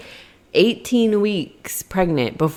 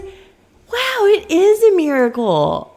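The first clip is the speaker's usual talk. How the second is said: awed wonder; 13 semitones higher, 5 dB louder, slower than usual. Retold or imitated. Her own awed reply.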